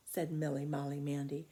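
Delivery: ordinary delivery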